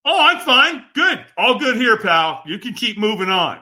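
high-pitched pretend all-is-well; mock breezy brush-off; fake reassurance. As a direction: wave someone off with put-on cheer. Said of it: "when we're not okay, what do we say?".

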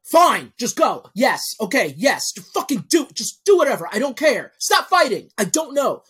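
high, loud frazzled parent giving in; exasperated parent. Acted. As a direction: lose your patience, building from firm refusals to yelling. Overwhelmed parent gives in to kids.

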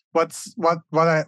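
normal talking voice

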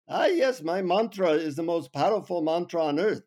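put-on Indian accent. Guru tells the traveler about his powerful mantra, in an Indian accent. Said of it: "in his Indian accent, he says".

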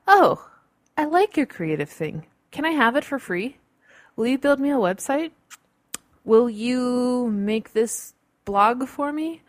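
mock wheedling friend. Friends asking a creative for free work, mock wheedling. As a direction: ask sweetly for a freebie, hammed up.

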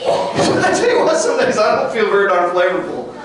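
chuckling as he owns up; laughing admission. Real, not acted. Preacher joking about his own bad days, laughing. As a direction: laugh through a rueful confession.

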